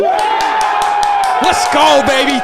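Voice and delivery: excited shout. Real, not acted. Host hypes listeners after the Gators clip, excited shout. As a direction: fire up the crowd.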